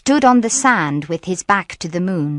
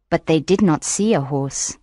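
plain reading voice

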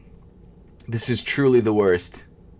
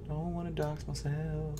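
murmured repeat; 4 semitones higher, 15 dB quieter than usual. Real, not acted. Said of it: "I don't wanna dox myself".

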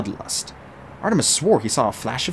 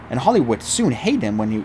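ordinary delivery

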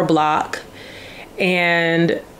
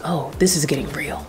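softly whispered aside; hushed, unvoiced realization; 5 dB quieter than usual. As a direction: whisper it to yourself in shock. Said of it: "I was like".

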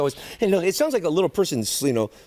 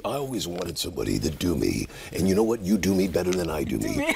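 deep announcer voice; 9 semitones lower than usual. Retold or imitated. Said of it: "he goes".

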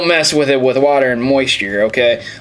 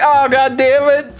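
much higher, squeaky exasperated mom; mock mom; 11 semitones higher than usual. Voicing the manual's mom who knocked over the PlayStation, squeaky exasperated. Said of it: "She's like".

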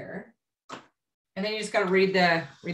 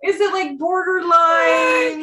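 teasing; 10 semitones higher, 7 dB louder, much slower than usual. Real, not acted. Teasing drawn-out question.

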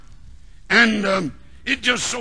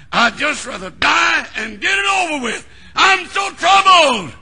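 anguished cry; 7 semitones higher, 5 dB louder than usual. Retold or imitated. Elijah under the tree, crying out in anguish. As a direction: wail it out in anguish.

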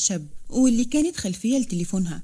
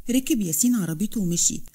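ordinary delivery, nothing marked

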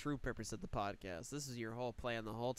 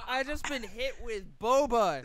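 high and loud, shocked; shocked exclaiming; 9 semitones higher, 10 dB louder than usual. Real, not acted. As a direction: exclaim right after being hit. Just hit by boba.

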